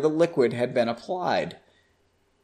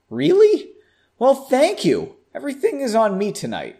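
surprised thanks; 8 semitones higher than usual. Acted. Bartender surprised and grateful.

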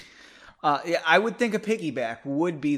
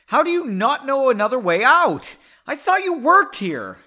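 much higher and louder, snapping in frustration; getting bitchy; 11 semitones higher, 8 dB louder than usual. Acted. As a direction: lash out at her, exasperated. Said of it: "that's her getting bitchy".

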